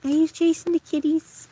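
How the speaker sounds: a hint of cooing baby talk; pet talk. Retelling what she asks her dreaming dog, mild cooing. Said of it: "I say".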